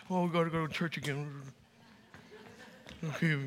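low, soft, dreary imitation; mock reluctant grumble. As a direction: imitate a grudging churchgoer, dropping pitch and volume. Mocks a reluctant churchgoer.

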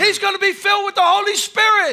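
loud, high proclamation; loud, high preacher's cry; preaching shout. Acted. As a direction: call it out with full force.

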